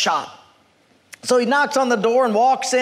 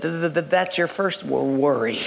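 put-on low, stammering old man; shaking elderly man; 7 semitones lower, 3 dB quieter than usual. Old shop owner to job seeker, shaky stammer. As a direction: stutter haltingly on the first sounds. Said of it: "this man is kind of shaking".

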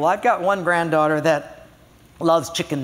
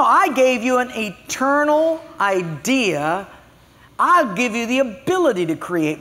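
raised, teasing rebuke as God; mock scolding God; 6 semitones higher, 3 dB louder than usual. Preacher voicing God to a proud congregation, teasing rebuke.

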